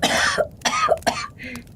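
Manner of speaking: hard choking cough; woman choking on smoke coughs; smoky coughing fit. Acted.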